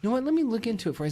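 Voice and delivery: high imitated shop-assistant voice; helpful clerk, pitched high; saleswoman. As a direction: imitate a saleswoman offering an alternative. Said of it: "She's like".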